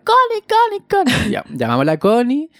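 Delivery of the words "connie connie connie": very high, louder call across a crowd. Retold or imitated. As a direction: shout the name to get her over. Calling seller across the crowd.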